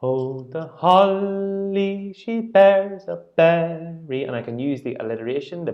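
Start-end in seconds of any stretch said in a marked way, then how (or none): 0.0-4.2 slow sung line, holly and bears leaned on; accent the beat words with the consonants; stressing the beat. Shown as a demonstration. Teacher sings, accenting the words on the beat. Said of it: "I can stress and I can accent".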